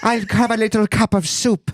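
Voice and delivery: high, hammy stage Irish; chirpy cartoon Irish lilt; Irish accent. Mock Irish guest ordering soup.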